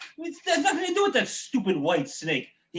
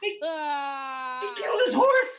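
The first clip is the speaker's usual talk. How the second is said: outraged cry; 3 semitones higher than usual. Real, not acted. Listener outraged at the story.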